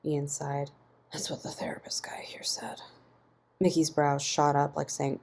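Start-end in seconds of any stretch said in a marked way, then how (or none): 1.1-2.8 soft, defeated whisper. A narrator's reading. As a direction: sigh it out under your breath. Ian sighs, answers quietly.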